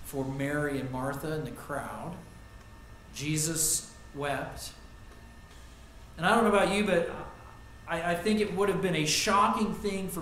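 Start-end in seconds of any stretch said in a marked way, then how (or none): 3.1-4.7 Jesus stressed, said very slowly and softer; slow solemn stress. Shown as a demonstration. Preacher repeating the verse a last time, slow and hushed. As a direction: draw Jesus out, quiet and heavy. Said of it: "This is a different word".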